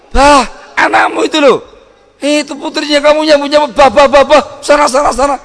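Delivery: high, loud scolding nag; angry wife. Retold or imitated. Wife scolding her husband, high and loud. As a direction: snap at him furiously. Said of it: "kamunya marah-marah".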